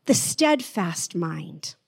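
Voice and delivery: slow, deliberate reading. Shown as a demonstration. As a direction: let each word sit slowly. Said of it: "read that again and slow it down".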